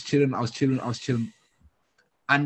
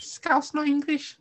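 very high-pitched mock Scouse; badly faked Scouse voice; horrendous Scouse accent; much slower than usual. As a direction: squeak it in a bad Scouse accent. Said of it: "That's a horrendous accent".

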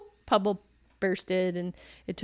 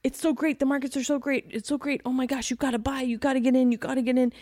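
higher, gushing market hype; hyped-up investor; 4 semitones higher than usual. Retold or imitated. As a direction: gush like an eager buyer. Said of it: "you have a lot of people who say".

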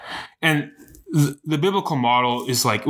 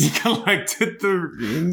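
slow, higher-pitched repeat through laughter; laughing; 7 semitones higher, slower than usual. Real, not acted. Listener repeating a friend's Thanos joke, laughing. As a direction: savour the joke while laughing.

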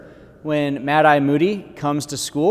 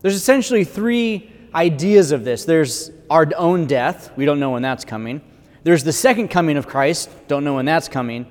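ordinary talk, nothing marked

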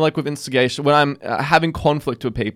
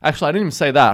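ordinary talk

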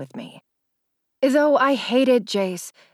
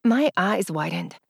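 usual speaking voice

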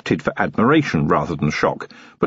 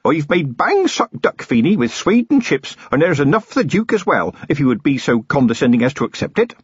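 nervous mother; 7 semitones higher than usual. A narrator's reading. Nervous mother offering meal.

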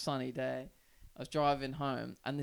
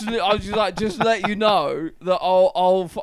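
loud threat; 4 semitones higher, 15 dB louder than usual. Retold or imitated. Biker threatens him.

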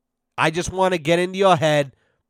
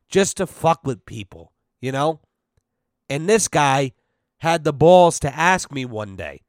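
ordinary talk, nothing marked

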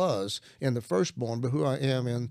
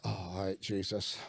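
much softer, lower, giving in; quiet surrender; 6 semitones lower, 7 dB quieter, slower than usual. Retold or imitated. Retelling how he stopped and gave in to Jesus, hushed and low. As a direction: let it drop to a hushed yield.

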